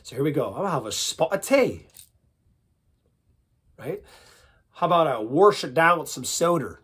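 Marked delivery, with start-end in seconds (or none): 0.5-1.9 put-on English tea-time accent; British accent. Shown as a demonstration. Your turn to try after me, British accent. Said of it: "let's do accent warm-ups". 5.1-6.8 loud regional "warsh" accent; American regional accent. Shown as a demonstration. Accent warm-up, loud American regional twang. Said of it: "let's do accent warm-ups".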